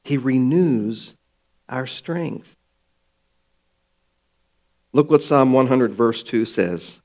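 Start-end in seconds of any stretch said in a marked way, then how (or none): none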